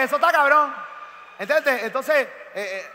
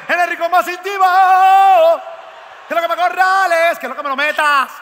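loud hammed-up diva voice, higher; sultry diva voice; 6 semitones higher, 9 dB louder than usual. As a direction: belt it like a brash diva. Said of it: "Con la voz cabrona que tiene".

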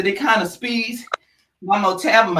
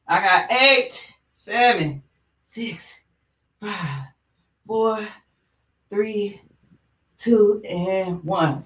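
slow strained counting; strained countdown; 2 semitones lower, 4 dB quieter, much slower than usual. Real, not acted. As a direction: count down through effort. Counting down plank.

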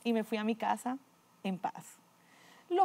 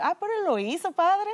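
higher, louder, quick objection to the priest; eager protest; 6 semitones higher, 4 dB louder than usual. Retold or imitated. She protests to the priest that he already did it, high and loud. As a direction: jump in to defend him, pitched up.

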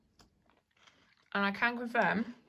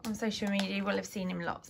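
normal talking voice

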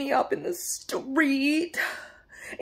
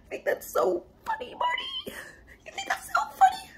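high, cracked sobbing taunt; crying taunt; 5 dB quieter than usual. Acted. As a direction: wail it through the tears.

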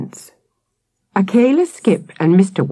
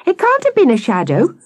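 put-on high-pitched protesting child; calling after someone; 10 semitones higher than usual. A narrator's reading. Sam calling after Akela, high and protesting. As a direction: call it out high. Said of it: "Sam called after Akela".

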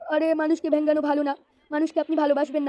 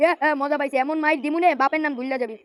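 ordinary delivery

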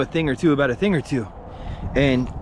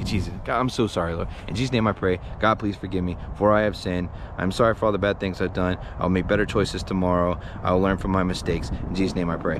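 low, soft, humble praying; earnest prayer; 9 semitones lower, 4 dB quieter than usual. Real, not acted. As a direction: pray for forgiveness quietly. Prays for forgiveness.

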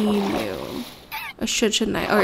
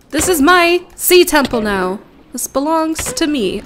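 villain gloating; 4 semitones higher, 7 dB louder than usual. Pretend. Claims the temple as villain.

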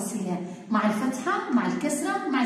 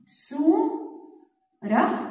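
سورة drawn out very slowly; stretched word; 4 semitones higher, much slower than usual. Shown as a demonstration. Teacher draws out سورة for the class, very slow. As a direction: stretch سورة so each sound is heard. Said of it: "وبدي أحلل سورة".

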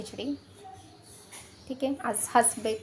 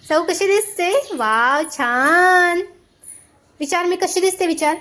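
delighted cooing; 6 semitones higher, 7 dB louder, slower than usual. Real, not acted. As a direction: gush over how lovely she looks. Admiring toddler after bath.

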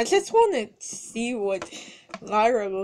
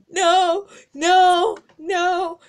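high, loud string of no's; panicked cries; 7 semitones higher, 6 dB louder, slower than usual. Acted. Pony calling Marzi for help, high and frantic. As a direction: cry no in rising panic.

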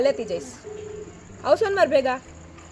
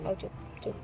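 soft shushing down to a whisper; calm her by whispering her quiet; hushing; 9 semitones lower, 14 dB quieter than usual. Real, not acted. Helper soothing a crying woman to stop, whispering.

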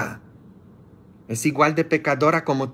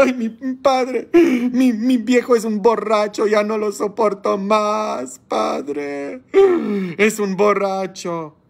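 mock weeping wife; 8 semitones higher than usual. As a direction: play the crying wife complaining about her drunk husband. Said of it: "toda llorando".

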